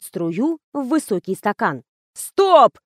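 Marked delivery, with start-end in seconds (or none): 2.1-2.8 high, loud yelped halt; взвизгнул. A narrator's reading. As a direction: squeal it out sharply, pitch jumping up. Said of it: "взвизгнул Жорик". (визгливый выкрик, громко и высоко)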